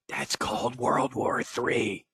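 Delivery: slow, breathy mock whisper leaning into the mic; creepy whisper. Host mocking Biden's whisper about tanks, slow creepy whisper. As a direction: imitate a creepy whisper into the microphone. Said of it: "he whispered into the microphone".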